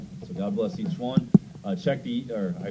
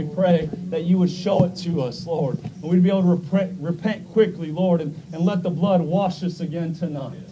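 raised, earnest praying voice; fervent public prayer; 7 semitones higher, 6 dB louder than usual. Real, not acted. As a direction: plead with God on behalf of the congregation. Leads congregation in prayer.

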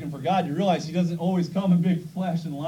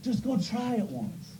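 soft, slow urging; a hint of a patient servant; gentle coaxing; 5 semitones higher, 5 dB quieter, slower than usual. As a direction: coax him gently to try. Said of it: "He says".

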